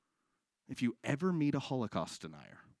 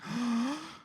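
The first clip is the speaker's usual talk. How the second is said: gasp; 10 semitones higher than usual. Acted. Recognising the man at bar.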